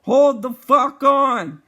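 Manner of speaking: loud, high, drawn-out warning shout; retold yell. Buddy warns passenger as the car drifts round a turn, urgent yell. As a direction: yell it slowly, every word stretched. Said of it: "he holds me back with one arm and yells".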